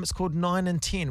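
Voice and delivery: ordinary delivery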